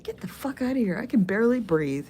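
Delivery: retold breathless outrage; indignant comeback. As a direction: snap it back as if fed up. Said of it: "be like".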